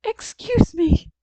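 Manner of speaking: put-on high, slow, loud little-girl politeness; most polite voice. A narrator's reading. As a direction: excuse yourself with exaggerated manners. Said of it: "in her most polite voice".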